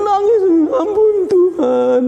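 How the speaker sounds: high, slow tearful plea for mercy; crying. Acted. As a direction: beg forgiveness through tears. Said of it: "dia menangis dan dia sangat sedih sekali". (memohon ampun sambil menangis)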